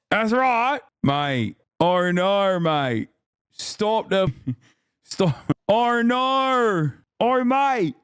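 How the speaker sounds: high, drawn-out British caricature breaking into giggles; silly high-pitched British impression; mock British accent. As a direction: ham up a Brit's protest, stretching the vowels. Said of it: "British people are like".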